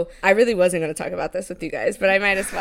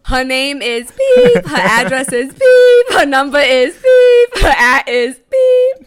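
loud, slow, higher, each beep spelled out; mock outraged shout; 5 semitones higher, 11 dB louder, slower than usual. Cohost exposing the bad reviewer to listeners, loud mock outrage. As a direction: bellow the hater's details like a mock exposé.

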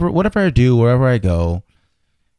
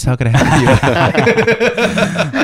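put-on slow drawn-out Saudi accent, laughing; a playful Saudi prince, laughing through it; 5 semitones higher, much slower than usual. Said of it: "talking in a Saudi accent".

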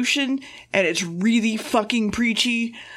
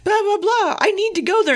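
much higher, insistent protest; squeaky outraged Jo; 10 semitones higher than usual. Retold or imitated. Retelling how Jo objects to the doctor's plan, high and indignant. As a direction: pitch it way up, like an indignant young woman.

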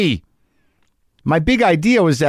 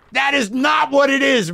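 scoffing shout; 9 semitones higher than usual. Acted. Inner cynic shouts down his talk of love. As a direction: shut down his sappy talk with a yell.

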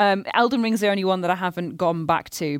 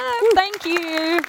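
playful thanks; 11 semitones higher, 3 dB louder, much slower than usual. Acted.

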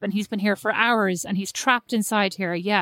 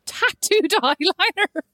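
laughing disbelief; 9 semitones higher, slower than usual. Real, not acted. Incredulous laughing question.

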